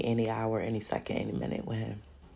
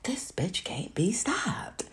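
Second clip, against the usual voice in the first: frustrated whisper of the devil; 4 semitones higher, 5 dB quieter, slower than usual. Retold or imitated. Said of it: "getting frustrated".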